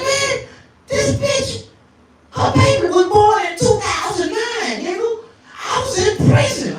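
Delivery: high, upset brother's voice; little brother. Retold or imitated. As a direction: squeal it like a panicked kid brother.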